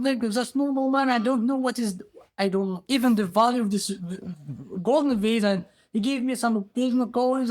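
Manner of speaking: high, flustered protest; acted simple man stammering excuses; pleading innocence. Ghirab pleads innocence to king.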